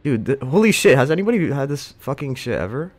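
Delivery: loud, high disbelief; shocked outburst. Real, not acted. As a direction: yell at the board in shock. Shocked by opponent's pawns.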